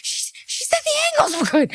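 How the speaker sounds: "the angles were good" pitched very high in disbelief; "angles" in a squeak of shock; squeaky disbelief. Real, not acted. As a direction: she repeats it, voice jumping up in disbelief. Repeating her line in disbelief.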